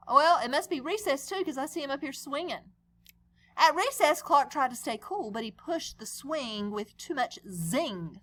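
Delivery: ordinary delivery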